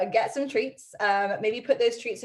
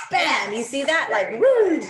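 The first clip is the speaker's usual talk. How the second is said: loud, high play-by-play whoops; loud, delighted outburst; excited exclaiming; 5 semitones higher, 8 dB louder, slower than usual. Real, not acted. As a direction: exclaim at the dog's quick turn. Narrating dog's excited head whip.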